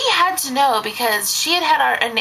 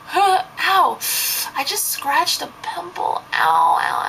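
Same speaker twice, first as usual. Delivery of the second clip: mock ow-ing over a scratched pimple, pitched up; faked pain cover; 7 semitones higher than usual. Babysitter crying at the concierge covers it with hammed-up ow's. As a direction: pretend the sting hurts to hide crying. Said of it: "I'm like".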